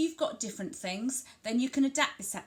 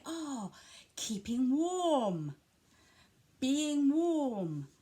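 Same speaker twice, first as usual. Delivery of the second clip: WARM stretched long in a raised, sing-song swell; emphasised describing words; 6 semitones higher, 4 dB louder, much slower than usual. Shown as a demonstration. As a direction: exclaim to a child, drawing out warm. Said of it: "using those words to describe".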